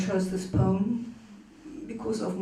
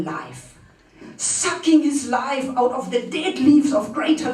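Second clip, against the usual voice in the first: dramatic poem recitation; 6 semitones higher, 4 dB louder than usual. Acted. Reader mocking the bourgeois male in verse, emphatic dramatic recitation.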